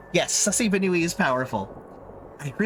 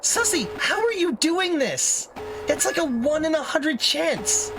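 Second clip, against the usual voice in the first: incredulous; 10 semitones higher, slower than usual. Real, not acted. Incredulous at player.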